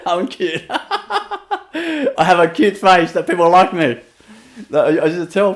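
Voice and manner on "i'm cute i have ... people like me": laughing through it, slow. Real, not acted. Telling listeners he was cute, laughing slowly. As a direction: chuckle at your own cuteness.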